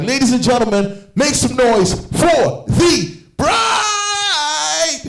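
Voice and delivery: high, rising hype-man call with the last word held long; drawn-out emcee shout, building to a big finish; hyped MC announcement. Acted. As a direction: introduce the star to a packed hall. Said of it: "while going to the audience".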